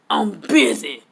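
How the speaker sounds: lightly voiced dismissive wrestler; curt brush-off. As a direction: brush her off, short. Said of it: "Like that".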